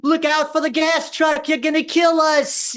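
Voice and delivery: high, loud panicked scream. Retold or imitated. Wife screaming at husband driving, high panic. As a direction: shriek at the driver in panic. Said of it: "she starts screaming".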